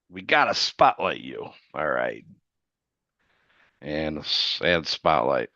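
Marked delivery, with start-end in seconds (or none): none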